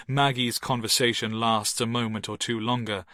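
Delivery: usual speaking voice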